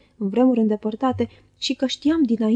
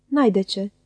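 ordinary delivery, nothing marked

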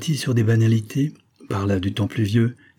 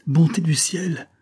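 higher, slow murmur of disbelief; murmured amazement; 7 semitones higher, slower than usual. A narrator's reading. Man overwhelmed by her proposal, slow amazed murmur. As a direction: breathe it out in wonder. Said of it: "murmura-t-il".

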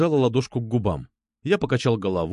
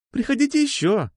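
put-on high, friendly café girl's call; calling after customers; 13 semitones higher than usual. A narrator's reading. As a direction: sing out a goodbye to leaving customers. Café girl calls after them. (приветливо, вдогонку)

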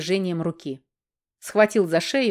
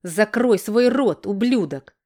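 slow, louder angry order; angry outburst; 3 semitones higher, 4 dB louder, slower than usual. A narrator's reading. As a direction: lash out, drawing the words out. Brother lashes out in quarrel. (сердитый окрик, медленно и громче)

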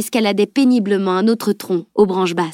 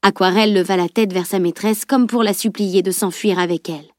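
ordinary delivery, nothing marked

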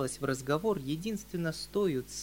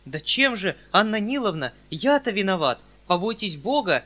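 louder, higher imploring plea; a pleading cry, higher and louder; 5 semitones higher, 10 dB louder than usual. Acted. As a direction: beg her to explain. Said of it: "умоляющим голосом".

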